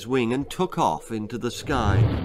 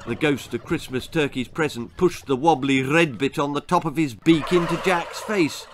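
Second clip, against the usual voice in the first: ordinary delivery, nothing marked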